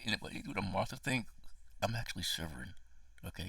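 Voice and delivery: ordinary talk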